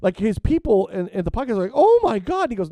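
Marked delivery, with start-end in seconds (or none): 1.7-2.5 mock shock. Podcast people's shocked reaction.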